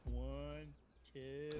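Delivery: drawn-out, deliberate count; imitated slow counting. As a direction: count painfully slowly, each number stretched. Imitates cashiers slowly counting nickels.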